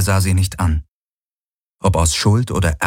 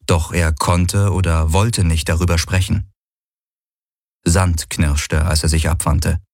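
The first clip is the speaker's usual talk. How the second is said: plain reading voice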